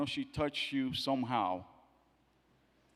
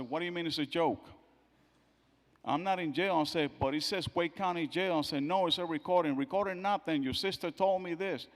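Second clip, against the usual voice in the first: louder, higher, arguing back; a father arguing over a prank call, raised voice; heated protest; 4 semitones higher, 5 dB louder than usual. Retold or imitated. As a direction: insist it's really the jail.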